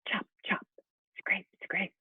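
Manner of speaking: soft breathy chop and scrape; whispered carving sounds. Acted.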